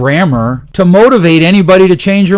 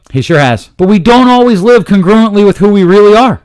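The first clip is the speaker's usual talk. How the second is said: ordinary talk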